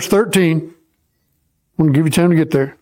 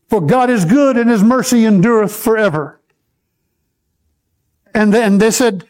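ordinary talk, nothing marked

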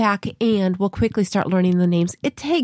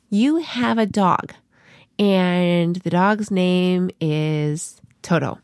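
slow teaching voice; much slower than usual. Shown as a demonstration. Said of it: "and I'll say".

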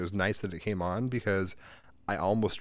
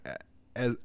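ordinary talk, nothing marked

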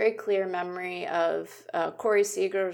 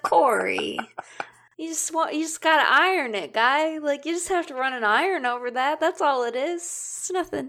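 exasperated advice; 8 semitones higher than usual. Retold or imitated. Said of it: "And I was like".

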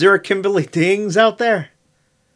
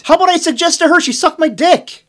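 heated rant; 10 semitones higher, 4 dB louder than usual. Real, not acted. Outraged rant continues.

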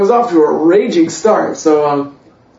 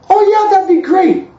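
much higher, mock-enthusiastic agreement; imitating eager girlfriend. New girlfriend accepting his parents' invitation, mock eagerness. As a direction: chirp a keen yes in a high voice.

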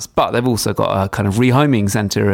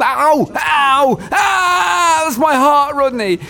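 mock pained cry; slower than usual.

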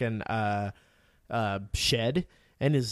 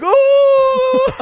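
very high, loud drawn-out cry; horrified mock wail; 13 dB louder than usual. Kid seeing open stall, loud high mock scream.

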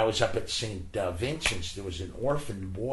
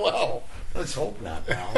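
slow and higher, laughing through the words; 7 semitones higher, 4 dB louder, slower than usual. Real, not acted. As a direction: chuckle it out as you answer. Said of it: "I started laughing".

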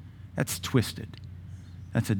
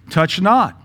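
loud, raised scripture quote; forceful scripture citation; emphatic quote; 6 semitones higher, 6 dB louder than usual. Acted. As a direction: declare it firmly. Preacher quoting scripture emphatically.

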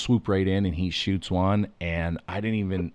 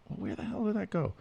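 puzzled mutter; 12 semitones higher, 8 dB quieter, faster than usual. Retold or imitated. Retold puzzlement while searching.

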